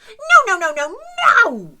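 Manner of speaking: high, loud shout of no. Acted. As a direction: yell at the monster to stop. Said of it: "shouted the group".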